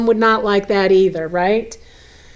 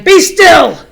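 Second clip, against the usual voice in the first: loud, much higher stern command; a small king silencing wild things; commanding boy; 8 semitones higher, 8 dB louder than usual. Acted. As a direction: order the monsters to freeze. Max commands the monsters.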